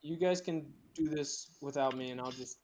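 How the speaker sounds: usual speaking voice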